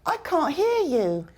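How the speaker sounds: long British vowel in "can't", ending on n. Shown as a demonstration. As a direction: drop the T of "can't" before "hear". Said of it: "I didn't pronounce the T".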